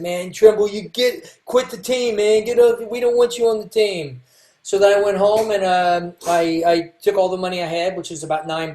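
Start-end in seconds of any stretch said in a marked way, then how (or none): 0.0-4.2 mild mock taunt from a bully, pitched up; a hint of jeering football players; taunting bullies. Football players telling the bullied kid to quit, mild jeering. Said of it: "They said".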